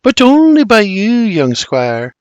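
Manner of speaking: high, lingering Scottish flirtation; sultry Scottish. A narrator's reading. Mary spins round to William, sultry Scottish tone. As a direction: tease it out in a sultry Scottish lilt. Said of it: "with her sultry Scottish tone says".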